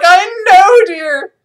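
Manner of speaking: high, loud mock wifely reply; exasperated wife. Wife answering her husband's introduction, mock exasperation. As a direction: blurt it high, dropping in volume at the end.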